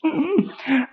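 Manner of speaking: real laugh, squeaking high, then fading; can't stop laughing at own story; helpless laugh. Real, not acted. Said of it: "मेरी तो हंसी नहीं रुकी यार". (खुद की कहानी पर रुक न पाने वाली हंसी)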